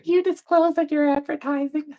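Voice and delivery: a touch of high, prissy commenter; lightly mock the nagging viewer; high mocking quote of a critic. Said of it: "you're like".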